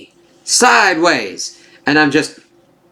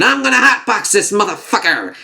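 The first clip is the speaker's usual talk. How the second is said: mock reckless fool; 8 semitones higher than usual.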